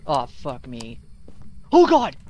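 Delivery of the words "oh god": very loud, high shriek of fright; terrified scream. Real, not acted. Player jumped by the ghost, terrified scream. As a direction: scream at a sudden scare. Jump scare in horror game.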